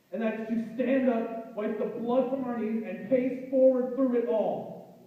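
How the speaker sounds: direct, emphatic plea in spoken-word rhythm; slam poem recitation. Acted. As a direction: press the listener, then urge them onward.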